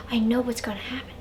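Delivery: hushed, quick whispered aside; fast, breath-only aside; mock knowing whisper. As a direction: whisper it like a bored spoiler. Acted weary aside at predictable plot.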